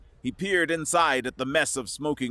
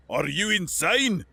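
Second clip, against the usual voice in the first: gasped; 7 semitones higher than usual. A narrator's reading. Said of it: "Foster's gasped".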